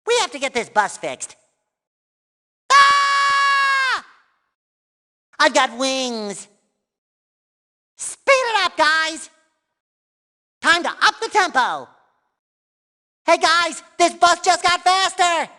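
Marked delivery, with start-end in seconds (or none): 2.7-4.0 long, high, loud "Ah!"; cartoon scream. Game character crying out mid-ride, high scream. 8.0-9.3 high, raised cartoon call; rally them with a high shout; excited urging. Character urging the guys to speed up, excited call. 13.2-15.5 high, sustained cartoon call; excited announcement. Telling the guys about the faster bus, excited call. Character calls out to passengers.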